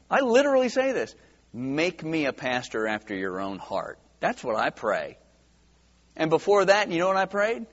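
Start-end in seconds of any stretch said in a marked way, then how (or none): 1.5-4.0 low, soft plea; earnest prayer. Retold or imitated. Pastor retelling his prayer to the Lord, humble and hushed. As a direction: pray it quietly, lowered in pitch and volume.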